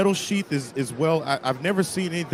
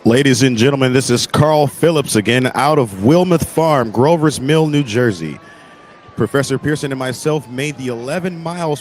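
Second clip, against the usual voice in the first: loud report over crowd noise; on-air field report; 9 dB louder than usual. Acted. Reporter calling out on location.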